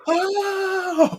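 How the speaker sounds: long high "oh" of amazement; high-pitched drawn-out mock awestruck "oh"; stunned "oh". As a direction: let out a long, high, loud "oh" of revelation. Acts out viewer's stunned reaction.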